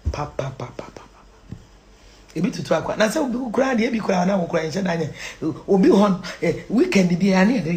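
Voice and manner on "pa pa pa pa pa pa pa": barely audible whispered patter; hushed, fading repetition. Real, not acted. Whispered repeated syllable.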